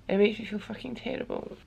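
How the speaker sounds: ordinary talk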